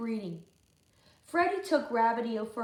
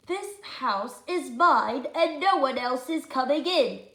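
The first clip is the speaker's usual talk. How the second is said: bossy George; 3 semitones higher, 7 dB louder than usual. Acted. George claims the house.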